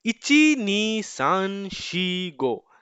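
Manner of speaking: teacher slowly drilling numbers for students, very slow and deliberate; slow counting drill. Shown as a demonstration. One number at a time, spelled out slowly. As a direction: count "इची नी सान शी गो" very slowly, each number apart. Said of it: "तो हमने अब तक क्या सीख लिया".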